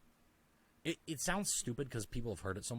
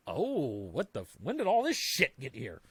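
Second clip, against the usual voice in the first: startled realization; 8 dB louder than usual. Retold or imitated. Noticing the baggage you carried, a loud startled outburst. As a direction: act stunned at what piled up. Acting out sudden self-realization.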